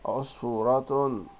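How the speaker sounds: "عصفورة" slowly as "us-fuu-ra-tun"; slow teacher's model of عصفورة with nunation; tanween damma ending. Shown as a demonstration. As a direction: stretch عصفورة to a voiced -tun. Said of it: "الكلمات التالية بالضم".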